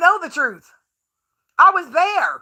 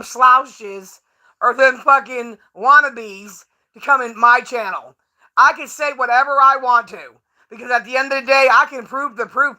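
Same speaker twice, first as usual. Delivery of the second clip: ordinary talk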